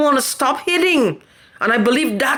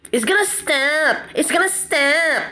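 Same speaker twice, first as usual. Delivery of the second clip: lightly mimicked sing-song reassurance, higher; mocking promise; 4 semitones higher than usual. Vlogger mocking promises he keeps hearing, lightly mimicked. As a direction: parrot the empty promise they keep giving. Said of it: "That's all I'm hearing".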